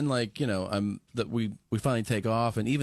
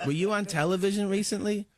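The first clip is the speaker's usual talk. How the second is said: Mike Tyson impression; 9 semitones higher than usual. Retelling Mike Tyson talking on plane.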